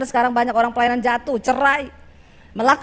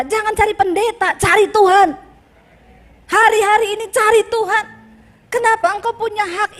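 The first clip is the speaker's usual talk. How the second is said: rising louder and higher to a forceful call; preacher's climax, loud and high; building preaching; 5 semitones higher, 3 dB louder than usual. Real, not acted. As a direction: drive it home, louder each phrase.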